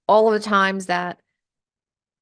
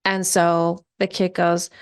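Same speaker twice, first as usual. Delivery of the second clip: ordinary delivery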